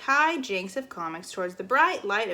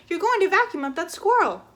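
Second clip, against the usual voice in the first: higher, louder warning; shouting; 8 semitones higher, 6 dB louder than usual. Acted. Flora warning the neighbor, shouting. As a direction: yell it through the glass. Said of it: "she shouted".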